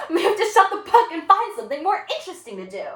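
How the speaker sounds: high, loud, mocking retort; unruly student. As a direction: fling it back with a laugh.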